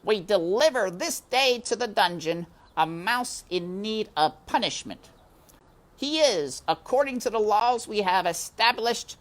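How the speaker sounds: high, loud ceremonial proclamation; high, loud formal mouse proclamation; solemn announcement. A narrator's reading. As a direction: proclaim it like a herald into the dark. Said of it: "he announced to the darkness".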